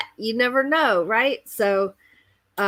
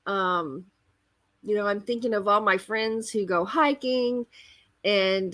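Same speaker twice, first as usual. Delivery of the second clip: ordinary talk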